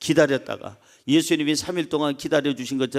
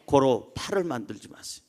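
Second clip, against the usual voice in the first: ordinary talk, nothing marked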